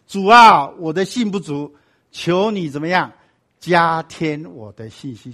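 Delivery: loud cry, then slow drawn-out plea; imploring prayer. Acted. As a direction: beg slowly, stretching each phrase after a loud call.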